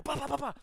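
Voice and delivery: high, loud comic bleats; silly yelping bahs; mock angel cries. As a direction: squawk them out as tossed angels. Angels thrown onto pile.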